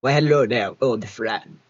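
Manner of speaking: changed voice. Shown as a demonstration. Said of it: "how many times I changed my voice there".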